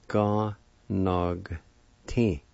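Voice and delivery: "Ga, nog, ti" in separate syllables with short pauses. Shown as a demonstration. Teacher repeats physician for learners, syllable by syllable. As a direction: repeat "Ga, nog, ti" carefully, broken into parts. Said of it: "like this".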